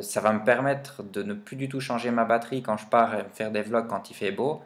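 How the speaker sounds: usual speaking voice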